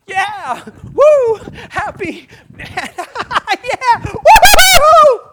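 whooping, high and loud, laughing; wild happy cheering. Shown as a demonstration. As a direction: cheer like you're bouncing for joy. Acts out happy jumps.

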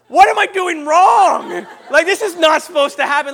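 loud, high comic wail of bewilderment; hammed-up inner yell, high and loud; exasperated outcry. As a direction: cry it out in mock panic. Acts out his panicked thought while kissing.

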